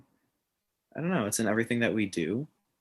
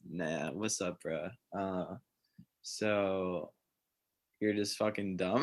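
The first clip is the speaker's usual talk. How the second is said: soft, lowered mock dude voice; bro voice; 2 semitones lower, 8 dB quieter, slower than usual. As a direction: play a laid-back bro, keeping it quiet. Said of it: "my broy voice for a second".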